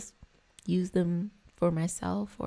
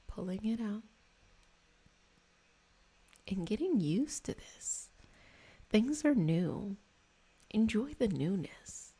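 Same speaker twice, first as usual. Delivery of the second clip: soothing guided-meditation voice; guided Reiki meditation; 3 semitones higher than usual. Acted. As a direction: ease them gently through the energy work.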